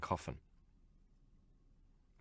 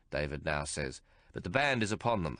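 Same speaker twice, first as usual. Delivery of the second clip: usual speaking voice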